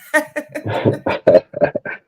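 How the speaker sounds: breathy unvoiced laugh; a burst of airy laughter. Real, not acted. Laughing at his own joke.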